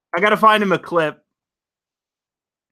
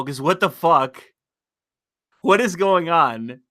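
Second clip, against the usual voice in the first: usual speaking voice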